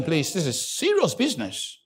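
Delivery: ordinary talk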